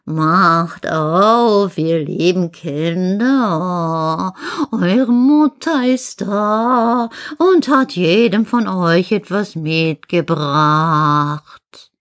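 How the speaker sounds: very slow, drawn-out sweetened wolf voice; wolf's fine voice. Acted. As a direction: coax in long, singsong vowels as a disguised wolf. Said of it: "machte damit seine Stimme fein". (fein verstellte, gedehnte Wolfsstimme)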